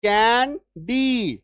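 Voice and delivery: कैन, डी split into two beats; कैन and डी pronounced separately; two syllables. Shown as a demonstration. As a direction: say कैन, then a long डी. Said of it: "दो syllable".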